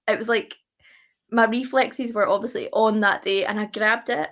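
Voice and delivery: ordinary talk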